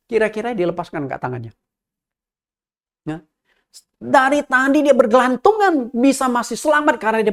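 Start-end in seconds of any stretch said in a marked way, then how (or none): none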